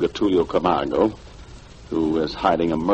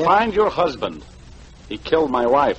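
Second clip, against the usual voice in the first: 1 heated accusation; 9 semitones higher than usual. Acted. Confronting widow over wife's murder.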